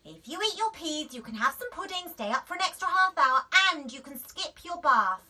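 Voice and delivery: eager mum. A narrator's reading. Mum getting desperate with bribes. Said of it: "said Daisy".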